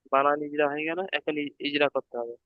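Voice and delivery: normal talking voice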